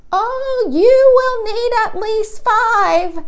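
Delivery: high, slow grandmotherly voice; a sweet old grandma, drawn out; high, slow old lady voice; Grandma's voice. A narrator's reading. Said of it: "she said".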